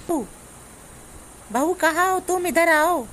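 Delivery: high, sustained call for the daughter-in-law. Acted. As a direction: call to someone in another room. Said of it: "जोर जोर से तुम्हें बुलाया चिल्ला के".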